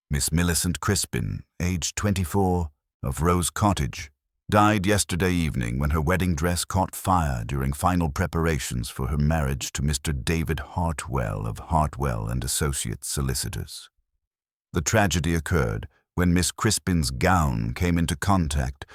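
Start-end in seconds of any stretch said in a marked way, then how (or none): none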